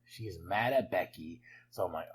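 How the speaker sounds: normal talking voice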